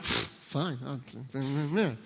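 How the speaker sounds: soft, huffy dismissive scoff; husband sulking at wife, scoffing; sulky scoff. Retold or imitated. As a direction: brush her off with a sulk. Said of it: "I got all like".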